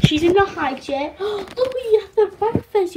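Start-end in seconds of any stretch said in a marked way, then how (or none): 1.1-2.9 excited gasp. Real, not acted. Showing doll breakfast.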